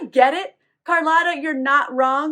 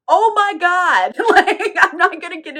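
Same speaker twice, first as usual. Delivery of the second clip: real laugh breaking through, higher and louder; laughing in disgust; 6 semitones higher, 5 dB louder than usual. Real, not acted. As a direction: laugh it off, half horrified. Grossed out by jars of heads.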